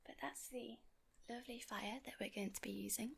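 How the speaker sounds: ordinary delivery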